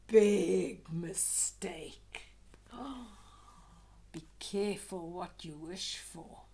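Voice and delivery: slow, low, loud then hushed foreboding; ominous warning. A narrator's reading. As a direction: warn them darkly.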